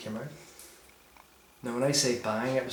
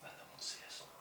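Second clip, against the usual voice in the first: soft, unvoiced whisper; 22 dB quieter, faster than usual. Real, not acted. Investigator whispers to partner in the dark, hushed. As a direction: murmur it conspiratorially.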